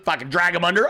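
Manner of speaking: ordinary delivery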